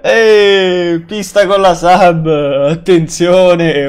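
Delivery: loud, drawn-out hype shout; excited announcement. Real, not acted. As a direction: hail a new subscriber loudly. Hailing a viewer's new sub.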